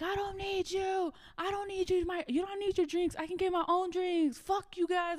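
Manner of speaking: whine-yell it high, imitating a defiant friend; imitated drunken screaming. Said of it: "you just started screaming".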